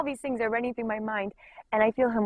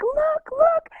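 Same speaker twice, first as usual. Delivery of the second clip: very high, louder excited squeal; acted-out giddy excitement; thrilled; 5 dB louder than usual. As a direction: urge them to look, squealing with delight. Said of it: "so thrilled and excited".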